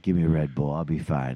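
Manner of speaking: low, soft pleading voice for his body. Retold or imitated. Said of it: "my body's like".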